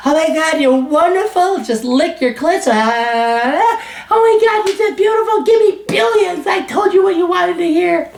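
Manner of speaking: high, gushing parody of a flattering guru; gushing mock Oprah fan. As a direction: fawn over the client in falsetto. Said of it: "We're the opposite of Oprah".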